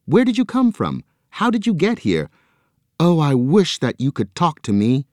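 high, eager rush of questions; excited questions. A narrator's reading. Joe pleading with the arm to talk, high and excited. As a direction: pour out the questions in wonder.

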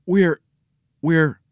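high, halting start; trying to explain. A narrator's reading. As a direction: falter as you search for the explanation. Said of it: "He tried to explain".

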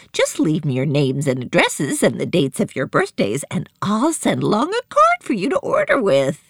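high, swooping seller's patter; high-voiced saleswoman showing off her stock; chatty, eager shop seller. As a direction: pitch the wares in a high, lilting character voice.

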